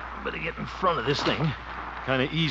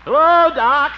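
calling hello; 13 dB louder, slower than usual. Acted. Greeting neighbour across lawn.

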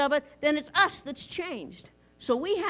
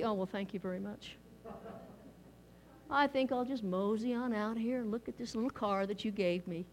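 softer, lower, bored and unmoved thanks; mock indifference; 6 semitones lower, 11 dB quieter than usual. Preacher mimicking a cold Cadillac recipient, softer and bored. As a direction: act totally unimpressed by the gift. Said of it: "It wouldn't be".

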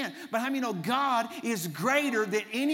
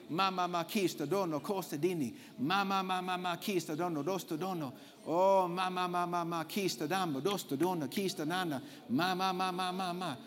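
soft rhythmic tongues, murmured; speaking in tongues; 4 dB quieter than usual. Real, not acted. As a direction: let the syllables flow softly. Praying in tongues.

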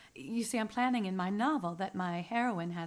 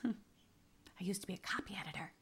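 soft breathy aside after a laugh; whispering; 7 dB quieter than usual. Acted. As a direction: let it slip as a whispered confession. Aside, confiding to the other woman.

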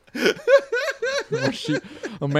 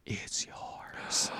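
whisper; 17 dB quieter than usual. Retold or imitated. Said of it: "he's like".

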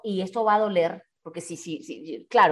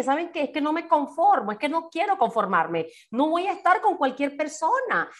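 firm high declaration; 6 semitones higher than usual. Retold or imitated. Quoting her own firm answer.

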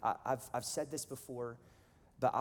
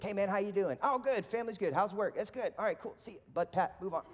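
higher-pitched, rattled-off casual exchange; breezy small talk; 8 semitones higher than usual. Retold or imitated. Churchgoers trading quick pleasantries, breezy. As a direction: act out a perfunctory friendly greeting.

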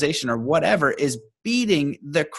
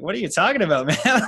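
laughing confused friend; 7 semitones higher, faster than usual. Retold or imitated. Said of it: "this friend just goes".

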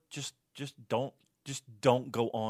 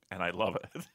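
laughing; 4 semitones lower, 5 dB quieter than usual. Real, not acted. Laughing at own confession.

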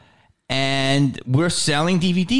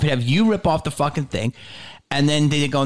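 normal talking voice

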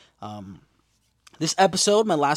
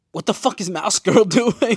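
higher, louder laughing outburst; laughing disbelief; 7 semitones higher, 9 dB louder than usual. Real, not acted. Reacting to Mouse Girl taking over, laughing loudly.

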